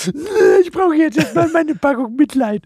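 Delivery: high, whiny mock self-pity voice. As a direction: whine it like a drama queen. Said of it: "aber du so".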